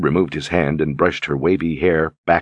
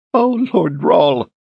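a slow, high, sobbing cry; 11 semitones higher, 4 dB louder, much slower than usual. A narrator's reading. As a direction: wail his name through sobs. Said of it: "she sobbed".

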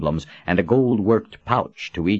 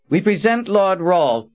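high, formal herald announcement; officious; 12 semitones higher, 4 dB louder than usual. A narrator's reading. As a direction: proclaim it like a stiff court herald. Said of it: "Verdine said in an officious tone".

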